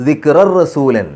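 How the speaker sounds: slow, drawn-out chanted recitation; measured Quranic chant. Shown as a demonstration. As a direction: recite it slowly with tajweed.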